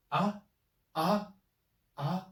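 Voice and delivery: ah rising up at the end like a question; second tone, like a question. Shown as a demonstration. Teacher showing the second tone as a question. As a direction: lift each ah upward. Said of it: "like question".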